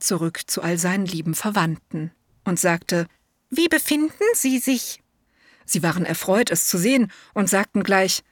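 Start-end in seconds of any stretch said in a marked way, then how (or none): 3.5-5.0 high, eager little elephant voice; a polite young elephant, pitched way up; elephant child. A narrator's reading. Elephant child answers the python in a high childlike voice.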